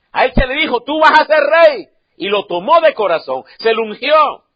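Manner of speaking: rising to a full preaching shout. Acted. Preacher proclaiming God's promise, loud shout.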